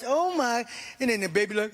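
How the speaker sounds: usual speaking voice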